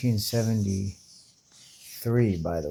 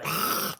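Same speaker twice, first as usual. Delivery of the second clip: mock gasp; 6 semitones higher, 5 dB quieter than usual. Real, not acted. Reaction gasp.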